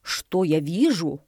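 slow granny exclamation; exclaiming. A narrator's reading. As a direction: exclaim with drawn-out weight. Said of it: "и воскликнула". (восклицает, протяжно)

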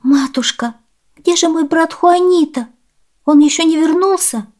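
put-on high, plaintive child's question; high-pitched worried pleading; tearful girl. A narrator's reading. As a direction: ask mother as an upset little girl. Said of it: "Пришла вся в слезах и спросила".

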